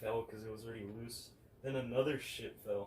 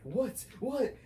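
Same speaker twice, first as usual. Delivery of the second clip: startled; 12 semitones higher, 7 dB louder than usual. Retold or imitated. Startled by mom's scream.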